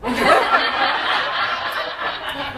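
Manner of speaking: a real burst of laughter; laughing aloud. Real, not acted. Storyteller laughing at her own tale. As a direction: laugh at the joke.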